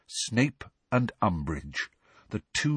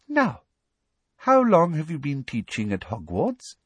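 prim high lady voice; 7 semitones higher than usual. Acted. Umbridge voiced in story.

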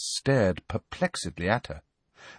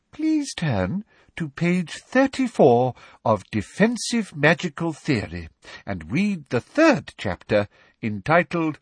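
lightly voiced prim schoolmistress, raised pitch; measured, prim instruction in a higher voice; smug teacher; 6 semitones higher than usual. A narrator's reading. Umbridge setting reading for her class, primly smug.

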